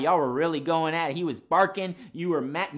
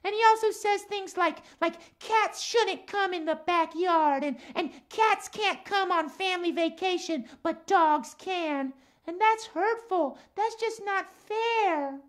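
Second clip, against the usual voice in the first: higher-pitched puppet voice, earnest complaint; lilting cat character, key words drawn out; Max the cat; slower than usual. Acted. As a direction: play a cat puppet airing a grievance.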